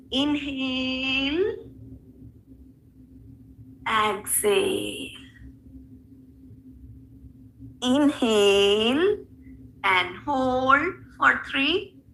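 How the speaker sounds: slow, drawn-out breathing instructions; slow breath cues. Shown as a demonstration. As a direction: draw each cue out slowly. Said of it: "guiding breath in yoga class".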